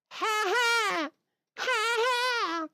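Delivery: a high, falsetto cartoon "ha ha"; hammed-up falsetto laugh; high mock laugh. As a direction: cackle it out like a cartoon character.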